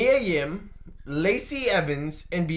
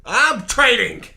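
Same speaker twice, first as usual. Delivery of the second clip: mock boss quarrel; 3 semitones higher, 6 dB louder than usual. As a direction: shout down a defiant wrestler. Acted boss-wrestler quarrel.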